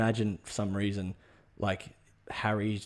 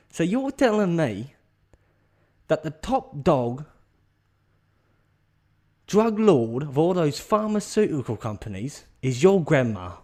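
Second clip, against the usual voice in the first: high, loud recap in disbelief; hammed-up gobsmacked mate; incredulous; 6 semitones higher, 5 dB louder than usual. Offsider stunned by the revelation.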